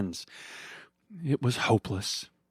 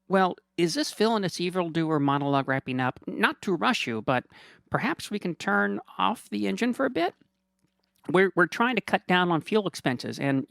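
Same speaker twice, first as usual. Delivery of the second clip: fast, high apologetic driver voice; meek limo driver cutting in; timid chauffeur Kevin; 8 semitones higher than usual. Acted. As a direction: nervously hurry the boss along. Driver Kevin interrupts politely.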